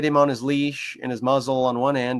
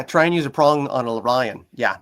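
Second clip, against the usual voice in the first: usual speaking voice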